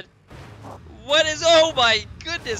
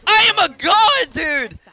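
high, loud boastful yell; triumphant shout; 8 semitones higher, 9 dB louder than usual. Real, not acted. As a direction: crow about the victory. Bragging after a win.

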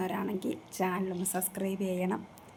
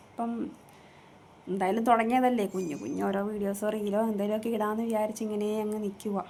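ordinary delivery

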